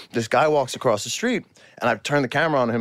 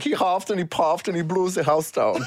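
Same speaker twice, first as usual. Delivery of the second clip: comic German storybook voice; Shrek pigs' German accent; 3 semitones higher than usual. Acted. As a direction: recite it like a German cartoon pig. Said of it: "the Three Little Pigs from Shrek".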